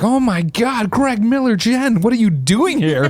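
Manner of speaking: high, slowed-down mock fan voice; imitating a thrilled conductor spotting Greg; excited conductor. Conductor recognizes them, excited.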